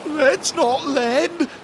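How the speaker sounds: high, slow alarmed shout. A narrator's reading. Tia warning the others about the shape, high slow shout. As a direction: warn them, eyes wide. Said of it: "shouted Tia".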